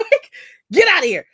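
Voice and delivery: laughing loudly while talking. Real, not acted. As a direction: laugh through the words. Laughing at her own story.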